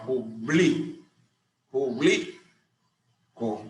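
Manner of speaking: publico spelled out slowly, stress on LI; slow stress demonstration. Shown as a demonstration. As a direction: publico stretched very slowly, lean on LI. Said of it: "recae sobre la penúltima sílaba". (publico lento, fuerza en la penúltima sílaba)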